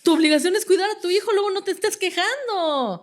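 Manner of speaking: high, rushed mock telling-off; exasperated scolding. Therapist answering the starstruck mother, exasperated scolding. Acted scolding reply to that parent.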